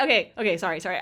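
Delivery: ordinary talk, nothing marked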